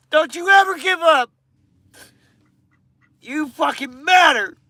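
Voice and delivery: high, impassioned pleading; real, emotional. Real, not acted. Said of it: "I'm sorry to get emotional".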